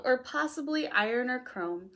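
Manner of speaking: plain reading voice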